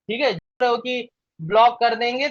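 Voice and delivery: ordinary talk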